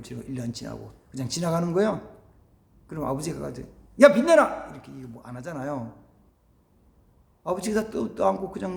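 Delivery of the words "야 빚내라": loud, higher bark; playful shout at the son; mock demand. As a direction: call out like a stern father. Father mock-demanding repayment.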